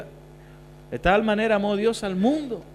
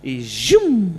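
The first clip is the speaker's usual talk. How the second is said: zum; 5 dB louder than usual. Retold or imitated. Sound of God's arrow striking.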